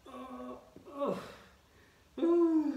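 rising strained grunts, last one loud. Real, not acted. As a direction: grunt through the last rep. Straining at end of hold.

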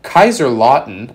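booming, slow hype-man announcement; hype announcer. Acted. Hyping the tournament result like announcer.

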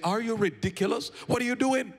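high, disbelieving friend's voice; the friend rebukes the widow at the funeral, high and disbelieving; incredulous friend. Acted. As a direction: scold her like a shocked friend. Friend scolds wife at funeral.

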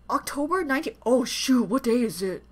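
ordinary talk

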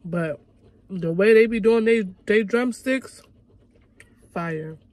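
talking between bites of wing; chewing while talking. Real, not acted. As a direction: mouth full of chicken. Seen 2: Holds wing throughout, bites it around 4-6 s, then chews.

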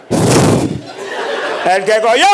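drawn-out call. Retold or imitated. Mother throws the cheese.

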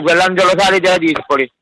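ordinary delivery

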